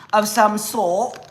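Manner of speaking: a hint of a put-on British accent; mock British accent. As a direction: toss the words off in a playful British accent. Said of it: "don't make me get into my British accent".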